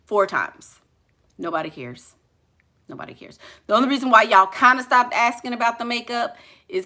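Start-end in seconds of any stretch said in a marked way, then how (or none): 2.9-3.4 breathy whispered repeat. Shown as a demonstration. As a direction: drop to a whisper for the echo. Repeats line, then whispers it.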